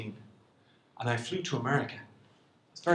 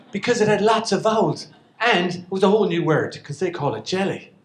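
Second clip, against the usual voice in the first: usual speaking voice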